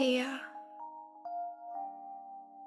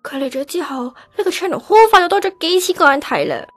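loud, forceful address to a crowd; hurt outburst; 10 semitones higher, 7 dB louder than usual. Acted. Replaced girl telling her fans to support her rival, hurt. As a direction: call out orders, hurt underneath.

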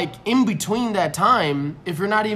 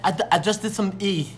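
imitating a thick-tongued lisp, laughing; mock swollen-tongue mumble; swollen tongue speech. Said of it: "You talk like this".

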